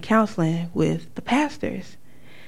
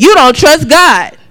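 louder, slow firm rebuke; stern blunt verdict; firm slow accusation; 8 semitones higher, 11 dB louder than usual. Retold or imitated. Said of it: "and he said".

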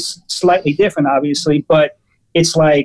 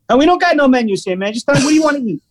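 imitated pushy waiter, higher voice; aggressive waiter; 8 semitones higher, much faster than usual. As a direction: play the waiter brushing off a customer. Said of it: "Really super aggressive".

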